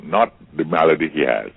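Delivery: ordinary talk, nothing marked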